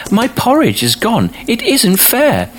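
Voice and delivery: very high, aggrieved little cub; squeaky baby bear complaint; baby bear crying out. A narrator's reading. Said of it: "cried the baby bear".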